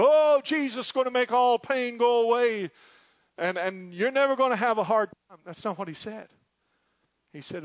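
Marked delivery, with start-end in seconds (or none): 0.0-5.4 much higher, louder hammed-up preaching; mock shouting preacher. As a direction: parody a feel-good shouting sermon.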